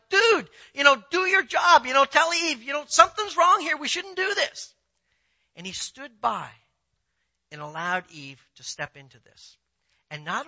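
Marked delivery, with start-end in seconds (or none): 0.1-4.7 loud, high-pitched mock scolding; raised voice of exasperated protest; exasperated urging. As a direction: urge him hard, as if shaking him into action. Acted scolding of passive Adam.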